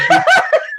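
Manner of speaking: quick high-pitched giggle; a short laugh burst; real brief laugh. Real, not acted. Laughing at sponsorship story.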